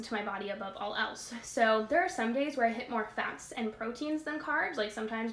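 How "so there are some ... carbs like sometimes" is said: usual speaking voice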